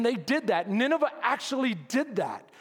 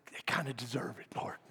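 whisper; 9 semitones lower, 10 dB quieter, faster than usual. Retold or imitated. Secret petty thought to God.

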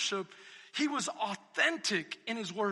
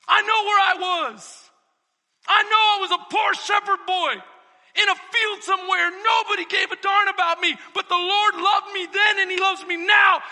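shouted, higher preaching voice; David's voice; 7 semitones higher, 13 dB louder than usual. Retold or imitated. Preacher voicing David before God, shouted. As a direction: shout it as David, full of conviction. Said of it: "He's saying".

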